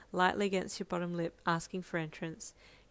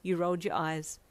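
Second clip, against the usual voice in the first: ordinary delivery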